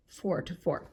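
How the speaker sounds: usual speaking voice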